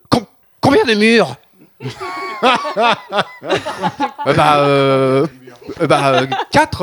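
pouffe de rire en parlant, plus haut; la question le fait craquer de rire; fou rire. Real, not acted. (il éclate de rire en plein milieu de sa question)

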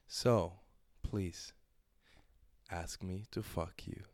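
slow her down with quiet authority; calm dominant demo. Shown as a demonstration. Coach demonstrating dominant line.

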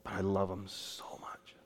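hushed tender whisper. Acted. God speaking of his love for sinners, whispered. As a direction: breathe it out like a secret.

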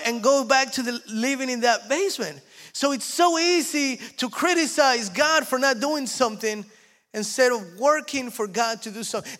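high, loud, fervent preaching; preaching at full force. Real, not acted. Preacher builds to full force before the congregation, high and loud. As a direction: drive the point home to the congregation.

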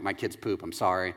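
low, soft confession; mumbled aside, dropped in pitch and volume; mock-embarrassed, hushed admission; sheepish apology. Dad apologising to visitors.

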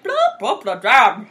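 a silly sing-song blah blah; mock drone. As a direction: toss off the words like a bored singer. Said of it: "it started to be like".

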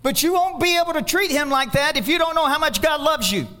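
high, loud, driving sermon voice; full-force preaching. Acted. Pastor exhorting the church on God's love, fervent high preaching. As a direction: preach it out to the whole congregation.

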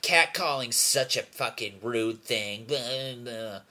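slow, mocking imitation of griping; hammed-up complaint trailing into blahs; mock complaining girls. Imitating girls objecting to catcalling, mock complaining. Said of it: "girls are like".

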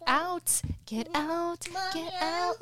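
high, singsong dismissal; exasperated shooing. Real, not acted. Mother shooing children out, high singsong dismissal. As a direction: chant it high to shoo them off.

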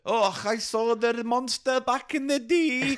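put-on high, slow old storyteller voice; leprechaun voice. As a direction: spin a yarn as an old fisherman. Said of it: "wait, I'm doing a story".

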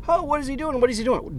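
high, exasperated "what is he doing"; impatient driver. Retold or imitated. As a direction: play the annoyed driver behind. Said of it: "this guy behind me is going".

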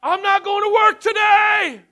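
full-throated high scream, slow. Retold or imitated. Man terrified by a vision screaming to the house, drawn-out yell. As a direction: yell it at the ceiling with all your lungs. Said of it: "I screamed as loud as I could".

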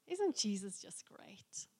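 hushed, breathy whisper of wonder; awed whisper. Real, not acted. Preacher marvels to the congregation, in a hushed whisper. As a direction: whisper it as if amazed. Awed aside after the healing.

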